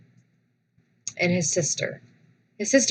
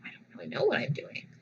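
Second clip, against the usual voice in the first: quiet admission; 2 semitones lower, 11 dB quieter, faster than usual. Retold or imitated. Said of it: "And I said".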